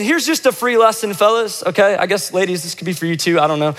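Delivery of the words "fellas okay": ordinary delivery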